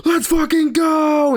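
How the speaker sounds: high, loud victory yell; hammed-up hype scream; retold screaming cheer. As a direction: scream it in triumph, stretched out. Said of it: "Stop screaming".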